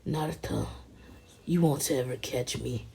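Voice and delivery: understated menace, measured and restrained; Itachi. Acted. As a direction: deliver it as a restrained villain's threat. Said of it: "And Itachi's like".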